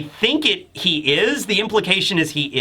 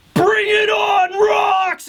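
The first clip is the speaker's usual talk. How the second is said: slow, high, louder roaring challenge; battle shout; 3 dB louder, much slower than usual. Retold or imitated. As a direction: bellow the dare across the battlefield. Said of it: "they just shout to get his attention".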